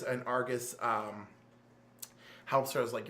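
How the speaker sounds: ordinary talk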